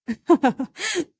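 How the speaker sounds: a short amused laugh from the mason; amused workman chuckle; mason's laugh. Acted. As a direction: laugh out at the huge plan.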